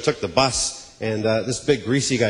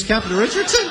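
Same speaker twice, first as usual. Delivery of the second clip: Captain Richardson asked high, rising in disbelief; high, rising Captain Richardson? of surprise; surprised recognition; 9 semitones higher than usual. Retold or imitated. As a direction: spot the old pilot and gasp his name as Captain Richardson?. Recognizing the pilot at Burger King.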